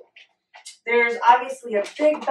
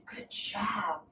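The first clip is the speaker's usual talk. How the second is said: soft unvoiced whisper of praise; 2 semitones lower, 13 dB quieter than usual. Real, not acted. Mom softly praises her toddler, whispered.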